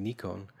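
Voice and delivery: Nikon said as NEE-kohn; Japanese-style pronunciation. Retold or imitated. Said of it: "the proper Japanese way to say it".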